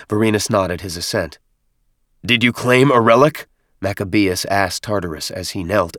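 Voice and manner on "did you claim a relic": high, loud commanding question; loud demand. Acted. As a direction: demand an answer sharply. Chieftain demands from nephew.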